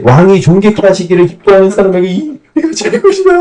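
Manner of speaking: high, hammed-up public announcement; mock proclamation. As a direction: cry it out like a herald parading the streets. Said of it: "하면서 막 외치고 다니는 거야".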